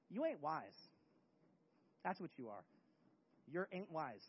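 low, quiet drop on the verdict; deadpan verdict. Real, not acted. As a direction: state it low and matter-of-fact. Preacher drops to a verdict, then shouts it.

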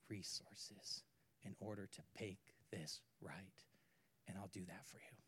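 soft, hushed master's forgiveness; a hushed, merciful reassurance; tender whisper. Acted. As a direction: whisper it gently like a kind master.

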